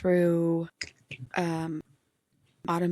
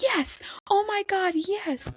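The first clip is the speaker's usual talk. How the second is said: high-pitched squeal of delight, retold; imitated excited yes; 13 semitones higher, slower than usual. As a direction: mimic her thrilled, much higher outburst. Retells author's excited reply.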